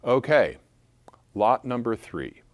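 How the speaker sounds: ordinary talk, nothing marked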